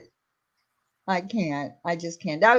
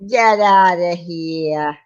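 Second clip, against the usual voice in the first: put-on drawn-out Boston accent, dropping the R; slow mock-Boston brush-off; 6 semitones lower, slower than usual. Streamer repeats the line to tease, Boston accent. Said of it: "That was my Boston accent".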